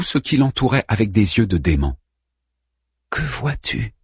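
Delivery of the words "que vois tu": softly asked question; hushed question; low voice. A narrator's reading. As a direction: ask it softly, barely above a breath. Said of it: "demanda Savi à voix basse".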